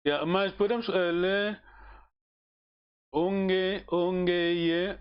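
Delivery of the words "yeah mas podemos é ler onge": usual speaking voice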